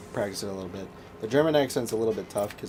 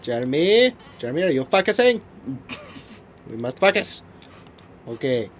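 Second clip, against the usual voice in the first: high, loud mock French Canadian director; drawn-out French Canadian accent, hammed up; thick French Canadian accent; 5 semitones higher, 7 dB louder, slower than usual. Director talking his actor through a shot, hammed-up French Canadian accent. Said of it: "he had a really thick accent".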